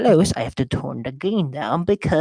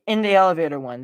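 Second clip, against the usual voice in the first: ordinary talk, nothing marked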